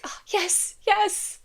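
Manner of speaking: high, thrilled little squeal; delighted high-pitched fangirl yes; gushing delight. Retold or imitated. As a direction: gush like a fan whose ship just sailed. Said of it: "you're like".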